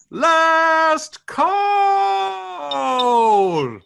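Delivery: loud, drawn-out last call; barman calling. Retold or imitated. Barman announcing last call to the pub. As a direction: call time across the pub.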